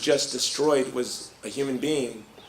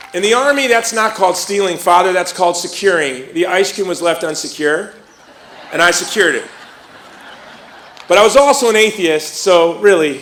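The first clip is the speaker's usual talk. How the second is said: playful teasing; 3 semitones higher, 6 dB louder than usual. Real, not acted. Teasing the priest to the audience.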